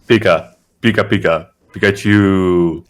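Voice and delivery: loud Pokemon chirps, drawing out the last; cartoon Pokemon call; Pikachu. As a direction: mimic a creature talking in its own name. Plays Pikachu answering in Pokemon speech.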